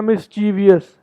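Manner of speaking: मिस्चीवियस in four syllables, mis-CHEE-vee-us; common mispronunciation. Shown as a demonstration. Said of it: "while many Americans says". (मिस्चीवियस को 'मिस-ची-वि-यस' बोलें)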